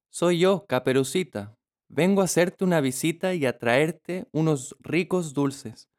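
put-on high, cheerful child; little girl voice. A narrator's reading. The girl at the door. (voz de niña alegre)

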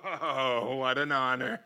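humbled and amused; laughing awe. Real, not acted. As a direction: marvel with a soft laugh. Surprised by big crowd.

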